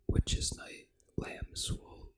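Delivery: ordinary talk, nothing marked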